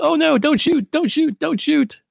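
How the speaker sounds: high panicked begging; panicked pleading. Retold or imitated. Moriarty begging Batman not to shoot, high and panicked. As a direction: squeal for mercy, way up high. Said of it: "And he's like".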